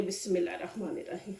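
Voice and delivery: fast, soft under-the-breath bismillah; hushed quick blessing before flipping the paratha; murmured prayer. Real, not acted. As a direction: rattle it off quietly to yourself.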